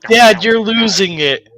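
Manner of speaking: high, loud and slow; a kid calling dad out. Acted.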